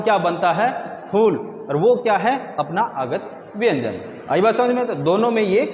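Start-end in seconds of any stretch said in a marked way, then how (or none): none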